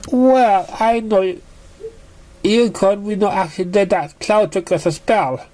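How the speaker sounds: high excited gushing. A narrator's reading. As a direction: rattle it off eagerly. Dash gushing about Pinkie.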